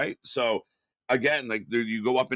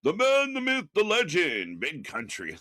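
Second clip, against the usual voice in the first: hammed-up ring announcer intro, higher and bright at the start; mock ring announcer; 6 semitones higher than usual. Host introduces Big Country to chat in a mock ring announcer voice. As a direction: boom it out like a wrestling announcer. Hyping up a guest's entrance.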